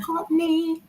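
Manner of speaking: high sing-song farewell; sing-song goodbye. Real, not acted. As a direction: call out the name brightly. Calling goodbye.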